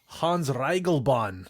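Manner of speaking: slow mock German accent on the name. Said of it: "We're going to do the rest of this podcast in German accents".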